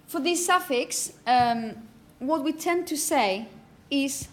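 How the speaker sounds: ordinary talk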